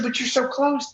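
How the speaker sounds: high-pitched, baffled objection; retold incredulous protest.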